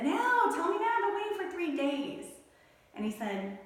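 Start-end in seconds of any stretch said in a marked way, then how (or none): 0.0-2.2 higher, louder impatient burst; demanding to hear it right now. Retold or imitated. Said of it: "I said".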